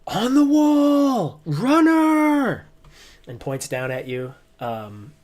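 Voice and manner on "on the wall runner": much higher, loud, slow drawn-out alarm call; sentry raising the alarm; guard shouting. Acted. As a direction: bellow a warning from the wall. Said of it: "he goes".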